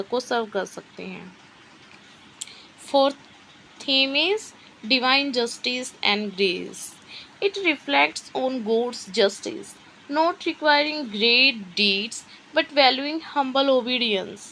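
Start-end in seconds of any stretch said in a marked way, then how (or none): 2.9-14.4 reads English notes out word by word, very slowly; slow dictation reading. Shown as a demonstration. Teacher dictating study notes to students, slow and measured. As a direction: dictate each word so students can write.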